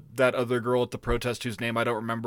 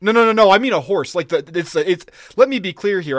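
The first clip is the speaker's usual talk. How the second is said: emphatic, raised denial; louder, insist you were misunderstood; loud protest; 4 semitones higher, 7 dB louder than usual. Real, not acted.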